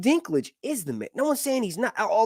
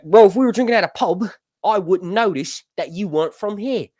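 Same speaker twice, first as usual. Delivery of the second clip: put-on imagined English pub-goer's accent; 2 semitones higher, 7 dB louder than usual. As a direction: mimic English drinking buddies in their accent. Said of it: "that's an English accent".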